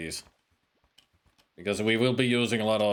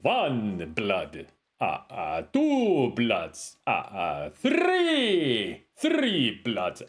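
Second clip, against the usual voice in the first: hammed-up Transylvanian accent, drawn-out counting; campy Dracula accent, rising on three; much slower than usual. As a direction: count bloods like a cartoon Dracula. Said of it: "sound like Count Dracula".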